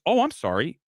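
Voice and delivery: usual speaking voice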